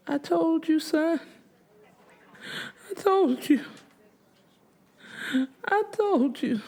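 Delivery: high, wailing grief; crying mother. A narrator's reading. Grieving mother to her dead son at the hospital, crying. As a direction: cry it out high over her dead child. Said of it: "She cried and cried".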